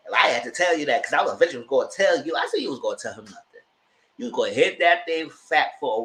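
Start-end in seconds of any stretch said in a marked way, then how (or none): none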